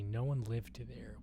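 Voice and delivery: ordinary talk, nothing marked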